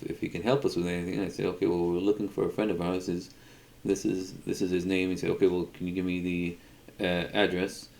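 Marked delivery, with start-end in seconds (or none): none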